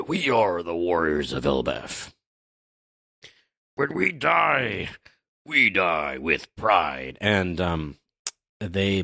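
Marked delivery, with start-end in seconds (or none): 0.1-7.2 slow, solemn stage declaration; giant warriors' vow. Acted. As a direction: proclaim it slowly as a proud warrior. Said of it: "And they say".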